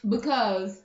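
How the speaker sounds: normal talking voice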